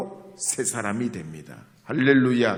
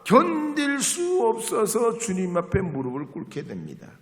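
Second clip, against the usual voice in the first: loud, high fiery preaching; preaching at full force; 11 semitones higher, 10 dB louder than usual. Acted. Preacher telling the congregation of the wild dawn prayer, fiery preaching. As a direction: pour it out like a revival preacher.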